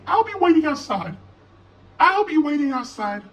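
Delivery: put-on high, light girl's voice. A narrator's reading. Lizzie leaving the house, high light voice. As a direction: lilt it high like a young girl. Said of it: "said Lizzie".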